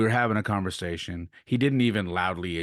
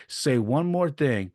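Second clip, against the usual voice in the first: slow, raised-pitch mock threat; retold warning; 6 semitones higher, slower than usual. Umpire threatening to eject the coach, retold warning. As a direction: imitate an umpire laying down an ultimatum.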